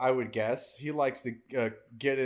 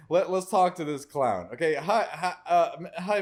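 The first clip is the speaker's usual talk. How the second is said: normal talking voice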